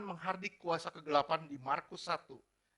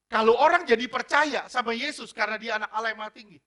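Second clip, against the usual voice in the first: higher, louder sermon build; fiery preaching; 6 semitones higher, 13 dB louder than usual. Acted. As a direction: drive the point home to the church. Preaching at full force.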